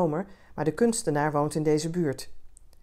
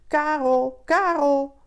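higher, louder call for a lost cat; searching call for a missing pet; 8 semitones higher, 5 dB louder, much slower than usual. A narrator's reading. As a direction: call into the dark street. Calling lost cat at night.

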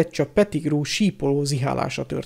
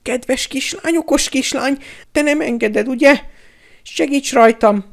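high-pitched wheedling plea; desperate little high voice begging for help; pleading coward; 11 semitones higher, 5 dB louder than usual. A narrator's reading. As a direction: beg and flatter in a high, thin voice. Pettigrew begs Hermione for help.

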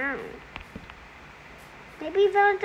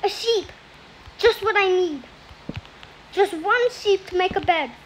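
normal talking voice

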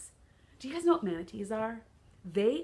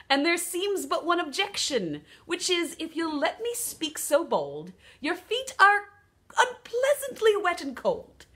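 hammed-up Australian kangaroo, higher pitched; Australian accent; 10 semitones higher, 11 dB louder, slower than usual. Kangaroo answering the duck's request, put-on Australian accent. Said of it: "Sorry about that Australian accent".

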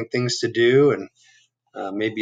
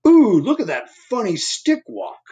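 teasing owl; 10 semitones higher, 4 dB louder than usual. Acted. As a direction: jeer at his walk, voice up high. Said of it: "said Owl".

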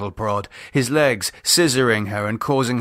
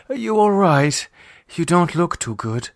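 concerned; 7 semitones higher than usual. Acted. Lisa concerned.

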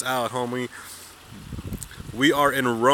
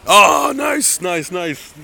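a much higher, louder "nice" burst; excited cheer; 5 semitones higher, 8 dB louder, much slower than usual. Real, not acted. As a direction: jump on it with sudden delight. Reacts to a catch.

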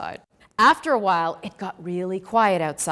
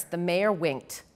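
usual speaking voice

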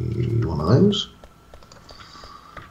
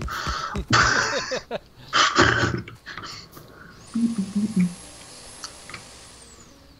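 high giggle; 4 dB quieter than usual. Real, not acted. Laughs at own alien voice.

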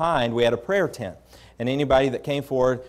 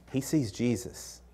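soft, slow and deliberate; hushed confiding; 8 dB quieter, slower than usual. Retold or imitated. Pastor leaning over to explain the man's vision, hushed. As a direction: lean in and confide it quietly.

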